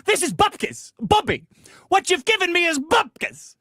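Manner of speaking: high, put-on Yiddish scolding; yiddish accent. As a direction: Yiddish grandpa dismissing it. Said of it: "and he'll say".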